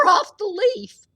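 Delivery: usual speaking voice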